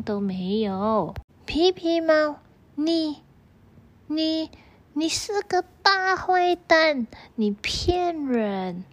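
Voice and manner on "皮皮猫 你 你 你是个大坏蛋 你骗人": high stammering outburst, fading at the end; angry accusation. A narrator's reading. Mouse angrily accuses the cat of lying. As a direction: sputter at the trickster. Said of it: "很生气".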